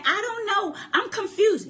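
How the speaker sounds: mock-helpless high voice; mock confused girl. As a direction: play the confused girlfriend. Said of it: "we start asking".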